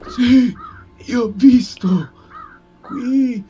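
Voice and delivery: loud, high, eager blurting; eager loud beggar. Acted. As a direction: blurt it out to grab attention.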